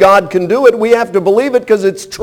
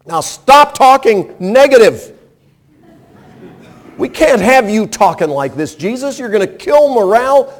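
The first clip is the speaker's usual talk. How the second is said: higher, insistent mock scolding; a higher, pushy rebuke, lightly voiced; Peter rebuking; 6 semitones higher than usual. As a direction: play the disciple pleading with his master not to talk of dying. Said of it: "He said".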